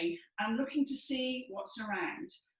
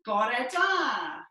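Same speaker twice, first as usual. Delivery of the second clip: shouted Bore da; Welsh greeting shout; 3 semitones higher, 8 dB louder, much slower than usual. Shown as a demonstration. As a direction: call Bore da across the street. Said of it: "the Welsh people get everywhere".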